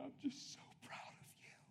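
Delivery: whispered, choked-up imitation of a crying father; imitating tearful dad. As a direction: whisper it as if fighting back tears. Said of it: "I look and he's crying".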